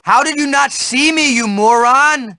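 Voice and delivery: normal talking voice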